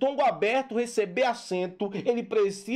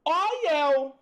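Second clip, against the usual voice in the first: high, stretched-out mock call; back-row student; 7 semitones higher, much slower than usual. Back-row pupil calling to be picked, high mock call. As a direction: hold each call high and long. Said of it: "O aluno fundão".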